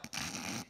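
low snorting snore; imitated snore. Hunter asleep on pine needles, imitated snoring. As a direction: snort like a heavy sleeper. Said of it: "And I snore".

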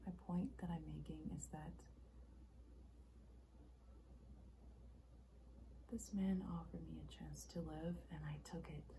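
soft, measured and restrained; understated confession; subdued explanation. Acted. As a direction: explain it quietly, with pauses. Acted monologue, subdued first take.

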